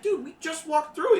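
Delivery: exasperated dude. Acted. GM voices teen.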